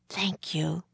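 soft, whispered thanks. A narrator's reading. Feverish drummer boy thanks Annie, whispering. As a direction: whisper it weakly, like a sick boy. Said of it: "the boy whispered".

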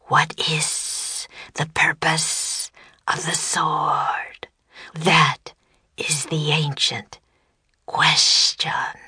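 very slow, whispery monster voice; low, deep serpent voice. A narrator's reading. Serpent posing the ancient question, slow deep voice. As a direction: intone it slowly, stretching the hissing sounds. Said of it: "In a low, deep voice, it said".

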